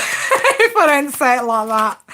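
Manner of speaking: real giggling mid-sentence; laughing. Real, not acted. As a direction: try to get it out while cracking up. Cracking up at own testy bestie joke.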